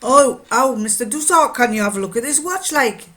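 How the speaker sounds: put-on Geordie accent. Miner asking to have his watch looked at, Geordie accent. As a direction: swing into broad, imitated Geordie. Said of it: "he'd say it in Geordie".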